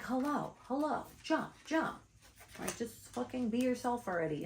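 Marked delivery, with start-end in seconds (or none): 0.0-2.0 higher, pushy call of hello and jump; mock urging voice. Reader voicing her guides urging viewers to jump. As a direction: egg them on to jump, playfully. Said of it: "It's like".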